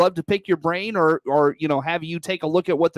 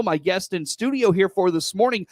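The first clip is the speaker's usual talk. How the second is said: usual speaking voice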